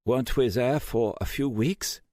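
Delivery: put-on high, pained German accent; groggy German hacker cursing. A narrator's reading.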